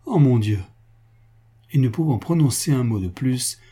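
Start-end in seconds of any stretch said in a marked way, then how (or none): 0.1-0.6 a hint of breaking down; stricken repeat. A narrator's reading. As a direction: repeat it sinking toward tears. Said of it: "elle fondit en larmes".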